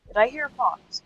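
ordinary talk

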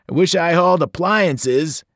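hammed-up grumpy hauler; grumbling trucker. A narrator's reading. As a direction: gripe about the heat like a crude trucker. Trucker complaining in story.

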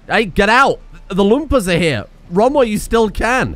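calling out a warning; warning shout. Acted. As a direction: yell at the visitors to run. Warning visitors Loompas are coming.

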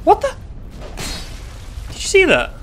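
high, loud startled outburst; real shocked disbelief. Real, not acted. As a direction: cry out in astonishment, pitch leaping up. Startled by odd game event.

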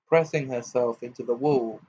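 ordinary delivery

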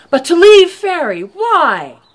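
loud protest. Acted. Thomas protests leaving Faerie.

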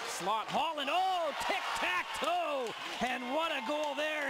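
high excited shout on the goal; long goal yell. Real, not acted. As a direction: stretch out oh, tic-tac-toe. Tic-tac-toe goal call.

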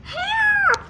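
very high, drawn-out yell; high-pitched alarm call; yelled cry for help. A narrator's reading. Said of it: "he yelled".

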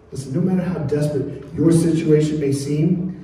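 ordinary delivery

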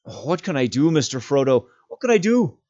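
high, loud, desperate shout; Sam shouting. Acted. Sam shouting down to Frodo below, desperate. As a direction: shout helplessly down the cliff. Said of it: "shouted Sam".

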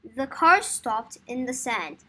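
plain reading voice

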